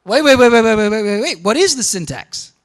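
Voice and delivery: mock urgent stopping a student; retold interruption. Lecturer retelling stopping a student's claim, urgent. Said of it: "I said".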